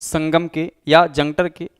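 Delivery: teacher dictating notes, one phrase at a time; dictation pace. Shown as a demonstration. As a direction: read it out phrase by phrase for students to write down. Dictating the definition of Sangam.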